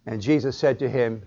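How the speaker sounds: ordinary talk, nothing marked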